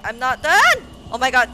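high, loud protest; panicked shout. Real, not acted. Streamer dies too soon, loud protesting yell. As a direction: yell it at the screen in disbelief. Dying mid-level in panic.

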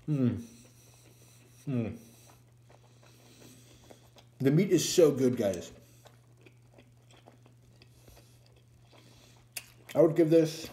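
talking with mouth full; mumbling between bites; tags: chewing burger. Real, not acted. Seen 4: Bites burger at -2, cheeks full, chewing while speaking.

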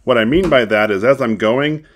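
muffled by fabric covering; speaking through cloth gaiter; through a face mask. Real, not acted. Seen 1: Fabric gaiter covers mouth and nose throughout; references show it off.

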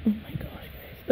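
slow, soft whisper; awed whisper. Real, not acted. Collector overwhelmed, telling viewers, awed whisper.

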